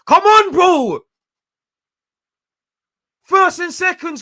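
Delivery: high, loud, strained yells at the players; screaming encouragement. Real, not acted. Fan screaming at Arsenal players to keep pushing. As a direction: scream them forward from the sofa.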